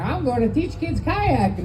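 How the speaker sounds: chirpy self. Retold or imitated. Comic plays himself, bright and chirpy.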